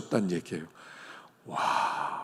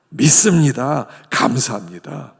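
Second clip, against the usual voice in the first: loud confident declaration; proclaiming aloud; 2 semitones higher, 9 dB louder than usual. Acted. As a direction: call it out boldly. Proclaimed response to sickness. (힘차게 외치듯)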